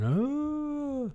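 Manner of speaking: long, steady high-pitched hoot; high drawn-out "oooh". Acted. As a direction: hold one very high "oooh". Acting out Gary Oldman's Dracula.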